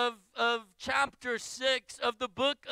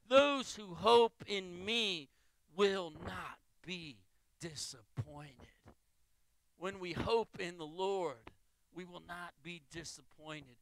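hushed scripture reading; 5 semitones lower, 5 dB quieter than usual. Acted. Pastor reading Isaiah's promise, fading to a hush. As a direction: let the promise sink down to a whisper.

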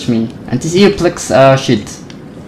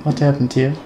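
ordinary talk

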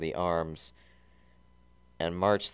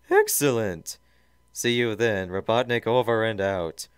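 loud, raised villain voice; Robotnik, commanding; 5 semitones higher, 7 dB louder than usual. Acted. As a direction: sign off like a tyrant. Robotnik signs off.

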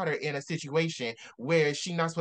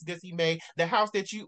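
ordinary delivery

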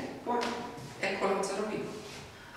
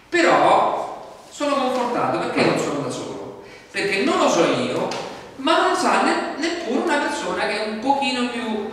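fervent preaching; 8 semitones higher, 6 dB louder than usual. Real, not acted. Preacher building to conviction.

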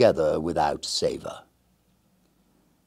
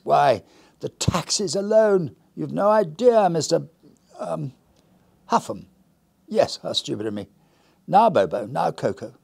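fussy lady of the house; 7 semitones higher, 3 dB louder than usual. Acted. Lady Winslow fretting to her guest, lightly voiced and higher. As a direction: complain lightly in a fluttery higher voice.

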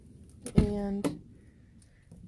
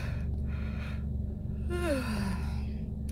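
weary sigh; 3 semitones higher, 3 dB quieter than usual. Real, not acted. Tired sigh mid vlog.

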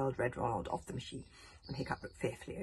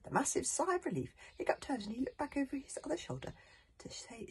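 usual speaking voice

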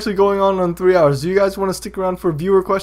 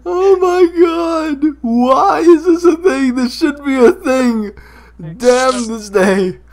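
high, slow wailing lament; mock anguish; 6 semitones higher than usual. Host lamenting the meme they created, mock anguish. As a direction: wail it like a tragic hero, hammed-up.

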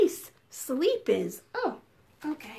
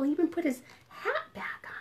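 whispering; 6 dB quieter, faster than usual. Real, not acted. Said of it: "be very quiet".

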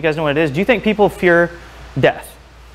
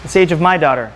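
normal talking voice

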